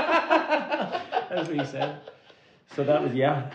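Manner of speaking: words slowed and broken up by a high laugh. Real, not acted. Laughing while repeating his dad's line to the host. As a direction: laugh through it, drawn out.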